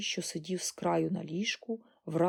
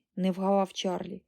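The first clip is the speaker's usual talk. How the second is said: usual speaking voice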